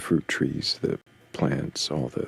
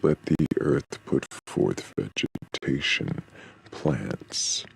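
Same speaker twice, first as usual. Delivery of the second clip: scripture reading. Acted. Reading Genesis passage aloud.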